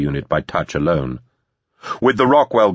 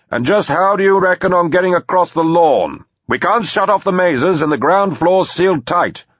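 high, sustained raised voice; shouting across; 11 semitones higher, 4 dB louder than usual. A narrator's reading. Arguing about getting across the lawn, shouting. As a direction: call it over a long distance.

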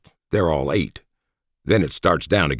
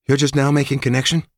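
higher, surprised woman's question; 11 semitones higher, 4 dB louder than usual. A narrator's reading. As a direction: ask it caught off guard. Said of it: "she asked, surprised".

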